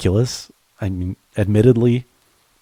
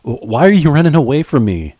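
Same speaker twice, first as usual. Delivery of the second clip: higher, louder, stammering plea; calling out upset; 7 semitones higher, 5 dB louder than usual. Acted. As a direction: shout the question at someone fleeing. Chasing partner, calling out.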